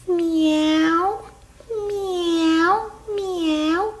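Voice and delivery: long rising imitated meows; cat meow. Little cat wanting a train ride, meowing. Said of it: "I'm a little cat".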